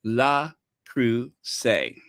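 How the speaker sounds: "Le Creuset" very slow, lɑ-kʁu-zé drawn out; slow teaching repeat. Shown as a demonstration. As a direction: drill it slowly for viewers to repeat. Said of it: "One more time".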